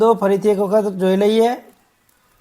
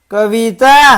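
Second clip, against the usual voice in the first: Kavita louder, with shorter syllables; loud drill word; 2 semitones higher, 6 dB louder, faster than usual. Shown as a demonstration. Teacher leads children to repeat after them, loudly. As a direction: call out Kavita for the class to echo. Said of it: "બોલજો જોરથી".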